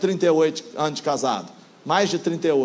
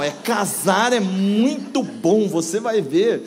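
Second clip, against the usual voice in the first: "Casar é muito bom" stretched slow and sung upward; exaggerated intonation; slower than usual. Shown as a demonstration. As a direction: draw out "muito bom" with a big swooping lift. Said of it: "É tudo entonação".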